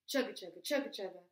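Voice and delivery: usual speaking voice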